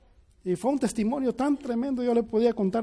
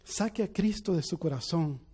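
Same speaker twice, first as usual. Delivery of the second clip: loud, raised preacher's voice; impassioned sermon climax; fervent preaching; 3 semitones higher, 3 dB louder than usual. Acted. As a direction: preach it to the congregation with fire. Preaching at full force.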